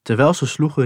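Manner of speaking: plain reading voice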